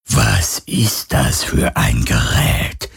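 low, slow whispery ghost; phantom voice. A narrator's reading. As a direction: ask slowly as an eerie spirit. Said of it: "fragte das Phantom". (tiefe, langsame Phantomstimme)

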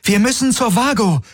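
very high, insistent cry; alarmed high-pitched urging; urgent calling out. A narrator's reading. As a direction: press them to go now. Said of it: "rief Kirai".

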